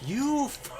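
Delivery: high shout cut off mid-curse; snapping at him in anger; angry snap. Retold or imitated. Snapping at the dirty roommate, high shout. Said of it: "snapped and was like".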